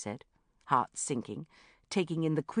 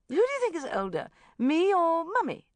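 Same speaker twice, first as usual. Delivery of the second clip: high, loud, coy quizzing; playful; 14 semitones higher, 7 dB louder than usual. A narrator's reading. Rebecca fishing for flattery from the child, playful. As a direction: coo it at a small child. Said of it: "Rebecca went on playfully".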